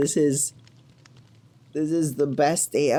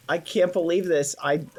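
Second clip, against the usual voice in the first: normal talking voice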